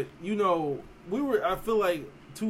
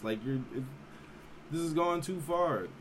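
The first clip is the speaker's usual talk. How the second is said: ordinary talk, nothing marked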